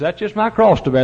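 a hint of a husband groaning about his mother-in-law; mock put-upon husband. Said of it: "mother-in-law comes to live with him and says".

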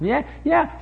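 high, jeering scoff; mocking. Kids mocking their father's warning, jeering. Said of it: "And they mocked".

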